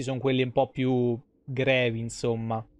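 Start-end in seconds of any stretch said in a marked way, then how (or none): none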